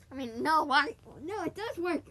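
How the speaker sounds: loud, startled self-correction; sudden loud protest. Real, not acted. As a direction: cut himself off with a loud no. Arguing with himself mid-podcast.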